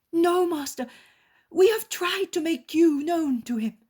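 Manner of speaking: high, loud, devoted disciple voice; earnest disciple. Acted. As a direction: answer the master with eager devotion. Disciple answers master.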